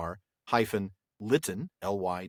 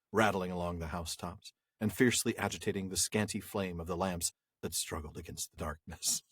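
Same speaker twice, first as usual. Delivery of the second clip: cheesy overly dramatic voice; 5 semitones lower, 6 dB quieter than usual. Shown as a demonstration. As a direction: read it like a hammed-up melodrama narrator. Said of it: "I'm gonna read this in that sort of cheesy, sort of overly dramatic voice".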